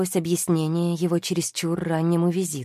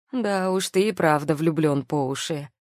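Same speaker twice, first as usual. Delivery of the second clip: plain reading voice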